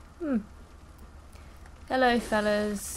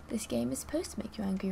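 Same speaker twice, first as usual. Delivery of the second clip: ordinary delivery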